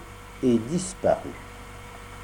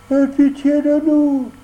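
tearful fox; 14 semitones higher, 5 dB louder than usual. Acted. Sly fox begs Pinocchio, whining with put-on tears. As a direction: beg with fake tears.

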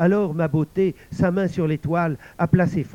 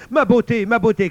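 high, loud wail of alarm; crying out; 9 semitones higher, 10 dB louder than usual. A narrator's reading. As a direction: scream out for your daughter. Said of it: "cria-t-elle". (cri aigu et fort)

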